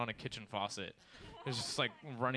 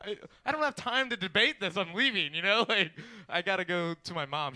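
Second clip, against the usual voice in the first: raised, louder comic protest; a touch of flustered insistence, pitched up; mildly acted exasperation; 6 semitones higher, 6 dB louder than usual. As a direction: argue your way out the door. Acts exasperated argument with the girl.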